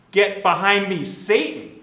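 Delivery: high, loud, forceful scolding; real rebuke. Retold or imitated. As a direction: hit him with a tough rebuke. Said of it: "real rebuke. It's like a tough one".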